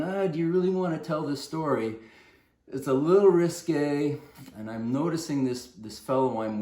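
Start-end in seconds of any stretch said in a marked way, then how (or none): 0.0-4.2 doubting inner voice. Retold or imitated. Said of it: "I've got a voice saying".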